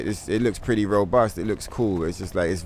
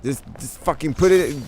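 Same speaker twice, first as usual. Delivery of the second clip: ordinary delivery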